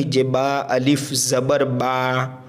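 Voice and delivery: letters drawn out one by one; slow spelling drill. Shown as a demonstration. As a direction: spell it slowly like a teacher. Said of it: "इसको हम ऐसे ही पढ़ेंगे".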